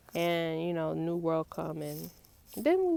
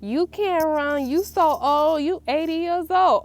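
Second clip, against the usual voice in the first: mock grandchildren taunt; 8 semitones higher, 6 dB louder, slower than usual. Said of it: "they like".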